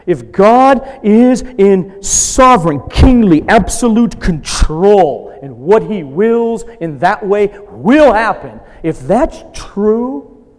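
loud, high, slow preaching build; forceful preaching. Acted. Pastor driving home God's sovereignty to the congregation, loud preaching. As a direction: proclaim God's control with rising force.

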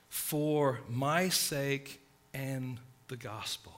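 each word slowed and set apart; slow, weighty reading. Shown as a demonstration. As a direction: let the key phrase land slowly. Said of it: "This is like a little phrase".

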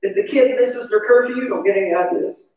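usual speaking voice

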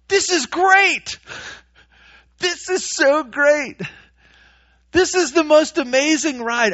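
mock thrilled. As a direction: play it like a thrill ride you'd pay for.

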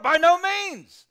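high, loud, slow; emphatic denial. Acted. Preacher thundering Paul's answer to the congregation. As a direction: hammer home the denial.

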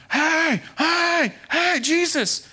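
loud, high-pitched shouts for attention; acted screaming over a crowd. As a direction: yell it out like desperate beggars. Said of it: "they start screaming".

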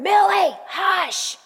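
mock yell down the hallway at a barking dog. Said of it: "And I'm yelling".

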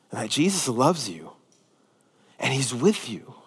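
quiet, sincere reassurance; soft earnest appeal. Real, not acted. Pastor gently reassuring the struggling congregation, soft and tender. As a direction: drop the voice low and tender, speak straight to the hurting listener.

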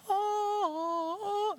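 preacher mocking a meek timid Jesus. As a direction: parody a timid pushover.

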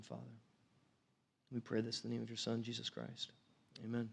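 soft, low, hushed prayer to God; quiet prayer. Acted. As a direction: pray gently and reverently, close to the mic. Opening prayer before sermon.

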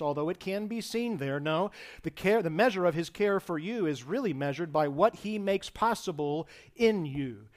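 normal talking voice

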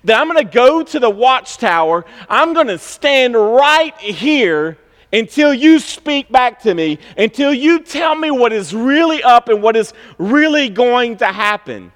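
a prophet demanding an answer from God, pleading; Habakkuk's complaint. Acted. Preacher voicing Habakkuk's complaint.

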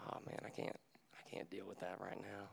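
hushed, near-whispered imitation of giving up; mock weary muttering. Pastor imagining Jesus dismissing his brokenness, whispered weary aside. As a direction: mutter it under the breath, as if fed up.

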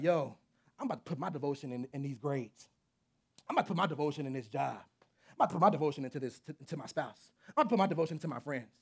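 low, rushed, casual boasting voice; voice of the heart. Acted. Preacher voicing his own heart, low and rushed. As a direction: rattle off where devotion goes.

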